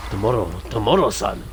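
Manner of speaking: tapped R's in a put-on Japanese accent; mock Japanese accent. Playing with the word after sounding Mexican, mock Japanese anime accent. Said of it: "that's Japanese".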